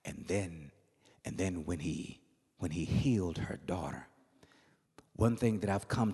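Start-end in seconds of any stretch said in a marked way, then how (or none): none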